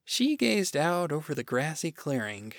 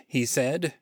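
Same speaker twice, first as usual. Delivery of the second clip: ordinary delivery, nothing marked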